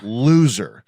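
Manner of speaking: LOSER drawn out on its own with a falling tune; weighty emphasis. Shown as a demonstration. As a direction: stretch LOSER so it sinks in. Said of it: "think about th-, what the word".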